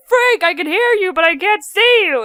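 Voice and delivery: high, loud cartoon wail; hammed-up separated-twin cry; mock panicked calling. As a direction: call out to someone hidden from view. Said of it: "It was like".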